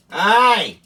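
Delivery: loud, high yelped "Aye"; a squeaky hillbilly exclamation; imitating Earl. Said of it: "that's how Earl is".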